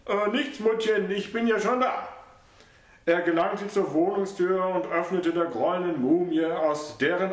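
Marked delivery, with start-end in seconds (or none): 0.1-2.1 lightly voiced, hurried son; slightly raised son's voice; flustered son. A narrator's reading. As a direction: hurry to appease her.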